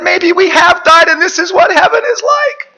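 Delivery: high, loud hysterical wail; acted tearful, hooting hysterics; weeping with laughter. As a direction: cry it out as if helpless with mirth. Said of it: "wept and gasped and hooted".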